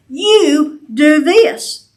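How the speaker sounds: very slow, "You" pressed home; a firm, pointed charge; pointed statement. Shown as a demonstration. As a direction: spell out "You do this" word by word. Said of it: "it's a pointed statement".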